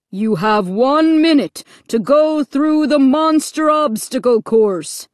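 loud, menacing loudspeaker announcer; thundering voice. A narrator's reading. Loudspeaker voice setting victims a deadly challenge, thundering. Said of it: "the voice thundered".

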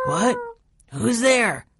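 high, startled sleeper's question; just woken. Acted. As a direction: call out as if roused from sleep. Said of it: "sounded as if he had been asleep".

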